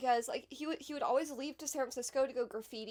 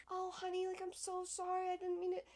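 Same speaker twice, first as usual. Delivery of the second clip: higher, softer syrupy apology, imitated; mock sweet mom; 6 semitones higher, 5 dB quieter than usual. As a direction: put on a sugary honey voice, exaggerated. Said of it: "trying to hug you and saying".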